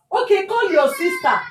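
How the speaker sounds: high-pitched exasperated command; retold anger. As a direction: re-enact giving in with an angry order. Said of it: "I was so angry at a point".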